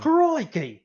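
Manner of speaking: high Aussie zookeeper exclamation; caricatured Australian outburst; exaggerated Australian accent. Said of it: "he just talks like an exaggerated accent".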